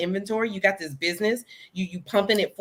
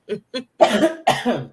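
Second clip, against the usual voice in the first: a sudden loud dry cough; sharp cough; 4 semitones higher, 4 dB louder than usual. Real, not acted. Guest coughing during live interview, sharp loud cough. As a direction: cough hard, caught off guard. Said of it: "Excuse me, y'all".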